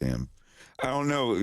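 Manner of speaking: usual speaking voice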